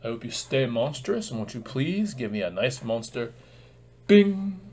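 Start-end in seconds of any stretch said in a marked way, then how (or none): 4.1-4.6 high, loud chime sound; ping it out like a bell; cheery bing. Pretend. Sound effect for a gift.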